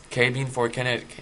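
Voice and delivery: ordinary delivery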